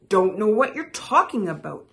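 quick, loud brush-off; shrugging. A narrator's reading. Frog brushing off Toad's jab, quick and dismissive. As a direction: rattle it off dismissively. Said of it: "shrugged Frog".

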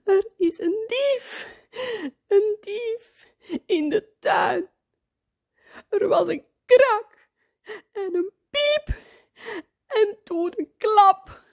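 put-on high, tearful frightened child; acted wailing, panicky and high-pitched; crying. A narrator's reading. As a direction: sob it out in bursts with gasps between. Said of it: "Job huilt".

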